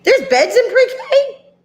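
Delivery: high, slow, astonished question; amazed. Real, not acted. Host reacts to child's answer.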